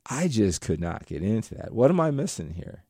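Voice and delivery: ordinary delivery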